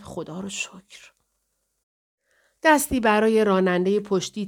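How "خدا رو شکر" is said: slow, hushed whisper. A narrator's reading. Paula as the stalled car finally starts, relieved whisper. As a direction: whisper it softly and slowly under the breath. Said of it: "پاولا نجوا کرد".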